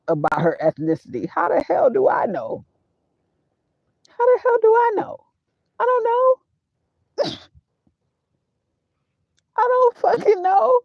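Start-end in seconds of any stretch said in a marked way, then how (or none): 1.3-10.8 high-pitched, incredulous outburst; exasperated ignorance. Real, not acted. Speaker telling Matt he can't know her ethnicity, exasperated. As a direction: send the voice way up in disbelief.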